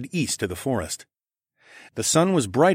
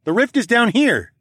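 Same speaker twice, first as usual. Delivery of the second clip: loud, raised-pitch call; 13 semitones higher, 6 dB louder than usual. A narrator's reading. Calling phoenix back toward the rift, shouting. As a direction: shout directions over the snow. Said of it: "I called out over the snow".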